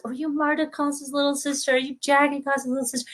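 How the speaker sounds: high, soft gushing questions; welcoming teacher. Retold or imitated. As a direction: imitate teachers cooing in recognition. Imitates welcoming English teachers.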